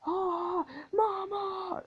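high, cracking wail; mock wailing soldier. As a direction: wail like a terrified recruit.

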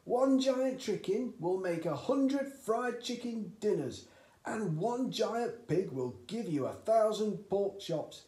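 high, loud, eager father; eager dad. A narrator's reading. Excited father urging his son at breakfast, high and loud. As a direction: push the boy on, thrilled with the idea. Cranky raves about giant animals.

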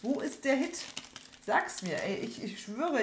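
ordinary delivery

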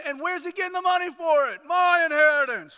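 loud, high outraged protest; indignant older brother. Acted. Wronged brother imagining the party's cost, loudly indignant. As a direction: build to an incensed peak on inheritance.

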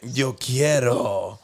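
very slow, drawn-out yo quiero; silly put-on voice. Repeating the phrase for his friend, slow goofy put-on voice. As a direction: stretch it out, goofy and deliberate. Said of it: "What is that voice?".

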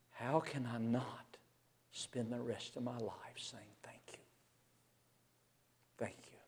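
whispered, almost silent thanks; hushed thanks. Real, not acted. Preacher quietly thanks God for forgiveness, in a whisper. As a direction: breathe out your gratitude.

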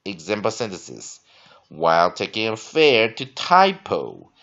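slow, loud, deliberate reading voice; dictionary read-aloud. Shown as a demonstration. Teacher reading definitions and example sentences to class, slow and emphatic.

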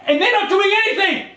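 high-pitched, agitated outburst; feigned panic, voice shooting up; frantic protest. Pretend. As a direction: protest in staged desperation. Pretend panic, arguing about police.

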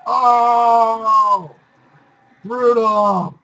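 loud, high startled yell; shocked shout. Real, not acted. Character killed in game.

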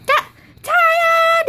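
high, drawn-out showbiz ta-da; mock-grand stage flourish after a trick; triumphant ta-da. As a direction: crow it like a magician's reveal. Backflip flourish.

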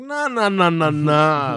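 high drawn-out nah, slowing down; refusing to let the kid off, slow mock nahs; mock refusal. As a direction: stretch the nahs out slowly, teasing.

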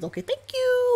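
a high, squeaky mock thank-you. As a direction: jump up into a tiny voice for the thanks. Retelling a grateful character's reply.

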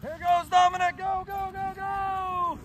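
high, soft-edged string of cheers; cheering on. Real, not acted. Cheering on a distant sledder.

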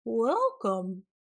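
lightly squeaky creature greeting; a hint of squeak. A narrator's reading. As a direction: call out a welcome in a little creature voice. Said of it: "a squeaky voice called out".

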